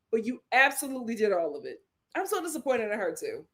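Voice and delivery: usual speaking voice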